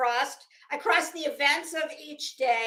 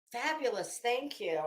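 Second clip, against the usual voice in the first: ordinary talk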